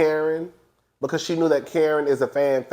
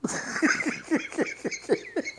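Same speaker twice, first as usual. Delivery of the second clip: breathy laugh; 12 semitones higher, 10 dB quieter than usual. Real, not acted. Laughing after the Giselle impression.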